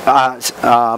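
ordinary delivery

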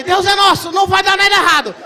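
high, loud shout of faith; hammed-up brave shout; loud self-reassurance. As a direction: yell courage at himself. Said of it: "eu falei".

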